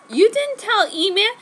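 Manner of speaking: put-on giddy girlish squeal. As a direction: squeal with delight at him. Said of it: "Jenny squealed".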